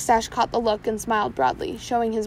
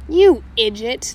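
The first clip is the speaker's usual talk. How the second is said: sneer; 9 dB louder, slower than usual. Acted. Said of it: "the captain sneered".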